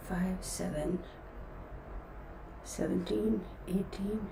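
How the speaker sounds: murmured counting while working out the chart; counting under breath. Real, not acted. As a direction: count quietly, almost to yourself. Counting houses under her breath.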